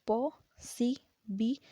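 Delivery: पॉसिबिलिटी said slowly, parts marked; syllable demo. Shown as a demonstration. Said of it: "पांच syllable". (पॉसिबिलिटी धीरे धीरे)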